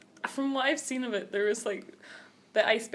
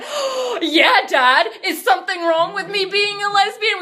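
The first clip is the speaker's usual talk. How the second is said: higher and louder, pointed question; teasing mock challenge; 9 semitones higher, 8 dB louder than usual. Girl and stepmom ganging up on dad, loud teasing. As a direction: throw the line back at dad.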